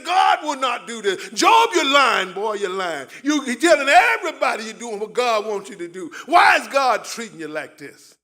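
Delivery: shouted accusations of Job's friends; loud mocking interrogation; accusing friends. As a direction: hurl the blame at him. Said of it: "They say".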